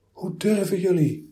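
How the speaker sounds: high, loud, stammered disbelief; God stammers, shaken and hurt; stammering. A narrator's reading. God stammers at the philosophers, shaken. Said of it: "stamelde God".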